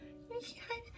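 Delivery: soft coaxing whisper. Real, not acted. Coaxing Papa to greet viewers in a whisper. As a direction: breathe it softly to coax him.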